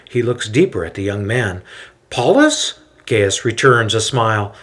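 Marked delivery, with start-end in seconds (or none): 2.1-2.8 very high, loud glad question; name him in happy disbelief; surprised recognition. A narrator's reading. Recognizing the young man.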